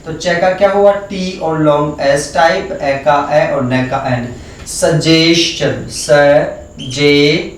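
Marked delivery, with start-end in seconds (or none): 4.7-7.6 suggestion said very slowly, syllable by syllable; slow demo. Shown as a demonstration. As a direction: stretch out suggestion for the class. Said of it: "slow spelled demo". (Suggestion को धीरे-धीरे बोलकर दिखाना)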